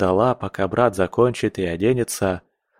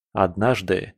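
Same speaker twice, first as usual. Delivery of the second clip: ordinary delivery, nothing marked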